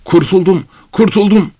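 high, elated exclamation; joyful cry. Acted. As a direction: cry out in relief, pitch climbing. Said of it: "diye sevinçle haykırdı Korotkov". (sevinçle, tiz sesle haykırarak)

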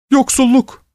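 very high, tearful plea on "yoksulluk"; pleading. A narrator's reading. Old woman begging mercy for the poor, pleading. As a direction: wail "yoksulluk" imploringly, high in the voice. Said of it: "diye yalvarıyordu".

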